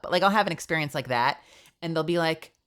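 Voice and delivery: normal talking voice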